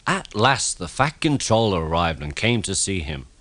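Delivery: ordinary delivery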